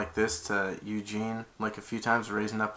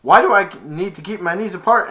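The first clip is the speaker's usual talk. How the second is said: loud, high repeated commands ending in a question; mock drill sergeant; drill instructions; 5 semitones higher, 7 dB louder than usual. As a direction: chant orders then balk. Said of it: "and they went".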